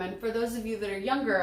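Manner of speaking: ordinary delivery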